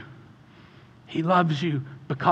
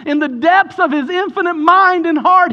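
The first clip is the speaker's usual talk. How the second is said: high, loud building sermon shout; preaching at full force; 13 semitones higher, 9 dB louder than usual. Real, not acted. Pastor shouting to the congregation at full force. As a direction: preach it out at the top of the voice.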